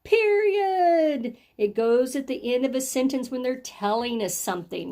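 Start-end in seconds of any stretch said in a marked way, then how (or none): 0.0-1.4 high, sung-out call; louder voice. Shown as a demonstration. Teacher has the class repeat the mark, louder voice. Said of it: "say it a little louder".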